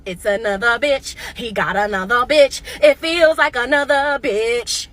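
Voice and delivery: slow suspicious. Retold or imitated.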